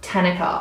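"Tanika" stressed up front as TAN-i-ka; short flat "a" in the first syllable of "Tanika"; mispronounced name. Retold or imitated. She repeats the main mistake, mispronounced. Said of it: "the main one".

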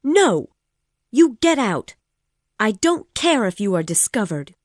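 loud, high shouted dismissal; furious refusal. Acted. Woman ordering her former lover out, furious shout. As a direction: drive him off, shouting.

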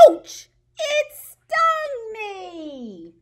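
high, loud pretend yelp of pain; mock ouch. Teacher pretends the bee stung her, high playful yelp. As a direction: cry out as if stung.

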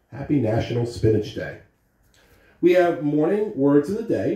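plain reading voice

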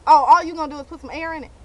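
normal talking voice

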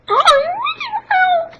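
very high squeaky mouse voice; Mickey Mouse voice. Pretend. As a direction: squeak it in a tiny falsetto. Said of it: "One hundred impressions".

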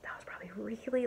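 whispers to the viewers, a little embarrassed; hushed aside. Real, not acted. Whispering after a loud noise.

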